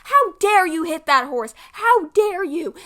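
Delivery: loud, high retold scream of the trainer; how dare you, shouted in anger. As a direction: yell it at her, outraged. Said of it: "is screaming at her".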